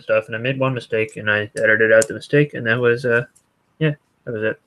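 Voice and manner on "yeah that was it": ordinary delivery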